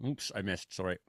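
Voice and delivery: talking through a mouthful of pizza; words around a bite of food; chewing while talking. Real, not acted. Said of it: "I'm trying to eat pizza".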